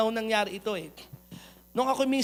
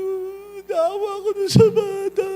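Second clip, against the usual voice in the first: tearful, higher, louder sobbing plea; crying; 9 semitones higher, 7 dB louder than usual. Retold or imitated. Young pastor telling his sister why he cries, tearful. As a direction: let the voice crack with pity.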